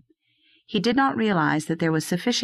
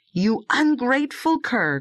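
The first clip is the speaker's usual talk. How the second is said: high-pitched indignant outburst; indignant cry of reproach; angry cry; 7 semitones higher than usual. A narrator's reading. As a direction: scold the dog with raised pitch. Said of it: "cried the harvester".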